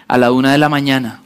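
usual speaking voice

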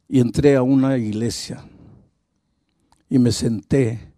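normal talking voice

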